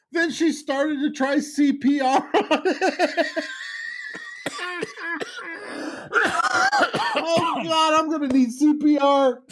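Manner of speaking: words squeezed out through helpless laughter; high, breathless laughing-through-words; laughing hard. Real, not acted. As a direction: try to talk while cracking up. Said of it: "Come on, come back".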